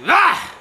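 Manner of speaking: sudden high loud yelp; frightened yelp. Acted. Scared cry.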